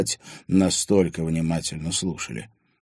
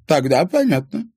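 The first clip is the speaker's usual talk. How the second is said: put-on knowing old lady; 11 semitones higher, 7 dB louder than usual. A narrator's reading. Grandmother understanding.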